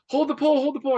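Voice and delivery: retold urgent shout. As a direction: imitate a skipper yelling urgent orders. Retold urgent order during shark strike.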